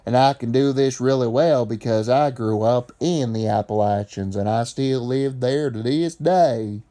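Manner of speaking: thick Appalachian Southern accent, vowels stretched; southern dialect. Shown as a demonstration. Appalachian native showing his region's speech in a Southern accent. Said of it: "They would speak like this".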